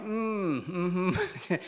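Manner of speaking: high, comic whimpering hum; mock whimper. Man told the word ego, mock whimpering acknowledgement.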